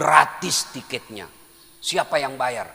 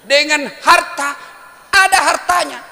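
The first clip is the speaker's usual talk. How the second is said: loud mocking punchline; 13 semitones higher, 13 dB louder than usual. Real, not acted. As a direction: hammer home the insult.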